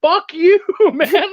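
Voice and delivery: mock outraged, pitched high with a laugh; indignant retort. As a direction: fire it back like an offended writer. Retold indignant reaction to feedback.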